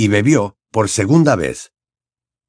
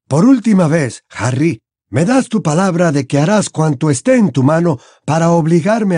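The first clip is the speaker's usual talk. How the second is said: higher, emphatic headmaster voice; stern, pressing character dialogue; Dumbledore, insistent; 8 semitones higher than usual. A narrator's reading. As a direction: press Harry firmly for his promise.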